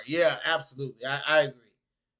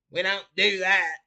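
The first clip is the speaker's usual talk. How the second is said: higher, louder mock country accent; 5 semitones higher, 6 dB louder than usual. Shown as a demonstration. North Carolinian denying he talks that way, mock country accent. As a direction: put on a heavy country accent, pitched up. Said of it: "with a country accent like that".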